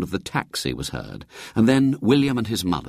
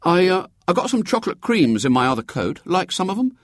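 put-on high-pitched, quick boy offering a treat; a small boy's eager, high voice; sympathetic boy; 11 semitones higher, faster than usual. A narrator's reading. William offering his seasick mother chocolates, sympathetically. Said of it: "hovered round sympathetically".

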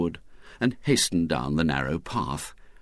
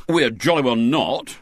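louder, higher indignant refusal; stung retort; 6 semitones higher, 5 dB louder than usual. A narrator's reading. William refusing the party invitation, stung. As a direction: snap back, stung. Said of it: "stung into speech".

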